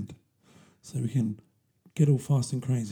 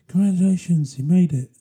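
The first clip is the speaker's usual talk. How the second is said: louder, higher, over-bright cheer; mock congratulations; 5 semitones higher, 7 dB louder than usual. Mocking birthday wishes for old age, loud cheer. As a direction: hail it like a sarcastic party.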